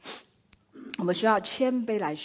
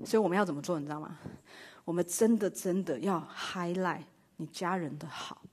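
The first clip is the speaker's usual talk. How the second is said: ordinary talk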